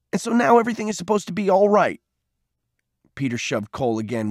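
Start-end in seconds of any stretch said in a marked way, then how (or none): 0.1-2.0 high, fast, incredulous question; heated and high-pitched; angry challenge. A narrator's reading. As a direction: fire the question back, disbelieving. Peter scorns Cole's explanation.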